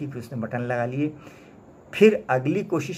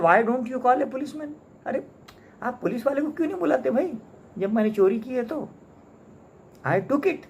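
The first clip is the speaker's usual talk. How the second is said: much higher, taunting and sneering; Soapy taunting the umbrella owner; contemptuous mocking; 9 semitones higher than usual. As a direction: dare him to call the police, mocking. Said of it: "बड़े मजाकिया और बड़े तंजिया उसको कहा".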